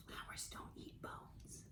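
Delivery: put-on hushed aside to the kids; whisper. Teacher whispering a joke aside to the class. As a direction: breathe it like a secret.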